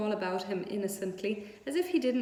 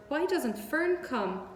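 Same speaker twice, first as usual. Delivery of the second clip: higher and slow, a plaintive little pig; close to tears; 7 semitones higher, slower than usual. A narrator's reading. Wilbur the pig wishing for Fern, near tears. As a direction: plead for Fern, almost crying. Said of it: "He began to cry".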